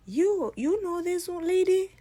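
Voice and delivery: high, loud, incredulous demand; shocked outburst. Acted. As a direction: blurt it out, stunned and loud. Sister confronts the guy in shock.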